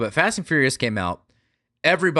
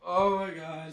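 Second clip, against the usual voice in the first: soft, higher-pitched drained moan; mock post-show misery under the breath; hunched-over despair; 5 semitones higher, 9 dB quieter, slower than usual. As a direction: groan it weakly, acting defeated. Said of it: "hunched over".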